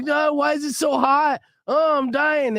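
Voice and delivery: high, drawn-out whine, imitating a miserable partner; imitated tearful complaining; mock whining. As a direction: whine and moan it, hammed up. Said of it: "like, whining and crying".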